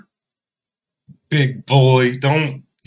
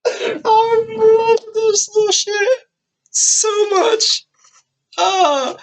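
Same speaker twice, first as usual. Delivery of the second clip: very high, drawn-out gushing; delighted squeal; much slower than usual. Real, not acted. Delighted reaction to the boy's boldness.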